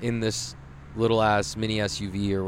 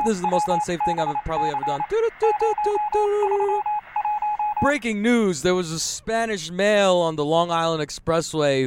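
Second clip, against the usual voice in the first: high, punchy parody newscaster; mock breaking news; 6 semitones higher than usual. Podcaster spoofing a news bulletin while driving in traffic, high mock-newscaster voice. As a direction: announce it like an urgent bulletin.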